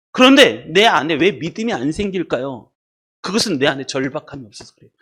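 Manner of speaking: ordinary talk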